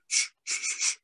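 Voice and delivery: breathy shh to silence a friend; urgent shushing. Acted. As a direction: hush him before they're heard. Holmes hushes Watson.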